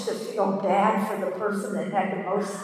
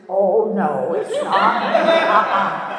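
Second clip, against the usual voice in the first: laughing denial; 6 dB louder, slower than usual. Retold or imitated. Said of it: "And I said".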